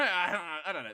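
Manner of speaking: giggling don't know; helpless laughing shrug. Real, not acted. Laughing indecision, loud.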